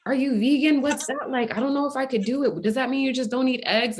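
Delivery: acted wide-eyed curiosity; curious stranger. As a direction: pepper them with friendly questions. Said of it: "And she's like".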